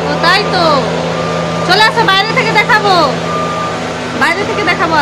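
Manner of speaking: high, sing-song calling. Real, not acted. Calling child from distance.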